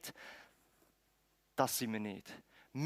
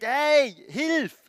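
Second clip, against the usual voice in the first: loud, high-pitched shout for help; beggar's desperate roadside yell; crying out for help; 7 semitones higher, 9 dB louder than usual. Acted. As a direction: call out urgently to someone passing. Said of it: "fährt an zu schreien".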